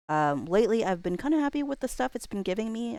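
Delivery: ordinary delivery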